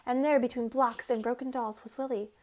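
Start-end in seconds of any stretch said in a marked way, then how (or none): none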